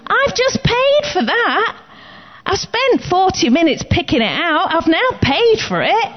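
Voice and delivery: assertive shopper. Retold or imitated. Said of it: "be a little bit more assertive".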